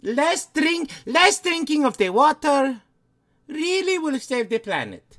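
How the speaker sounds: put-on high, hammy Eastern European accent; Lady Bathory accent. Lady Bathory pitching green living to Stephen, Eastern European accent. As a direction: make an earnest case in a thick put-on Eastern European accent. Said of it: "people said they liked your accents".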